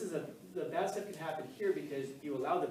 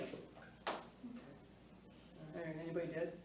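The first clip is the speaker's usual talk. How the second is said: softer, unbothered, lightly voiced calm; laid-back reply; 10 dB quieter than usual. Teacher imitating the principal answering the panicked student, mild calm. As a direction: shrug it off like nothing happened. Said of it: "He was just so laid back".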